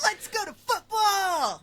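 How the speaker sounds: high, loud and drawn out; excited cry. Retold or imitated. Teasing the host who jumped ahead to football. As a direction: cheer it like a kid itching for football. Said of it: "you're like".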